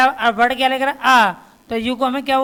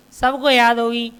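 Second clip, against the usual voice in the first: normal talking voice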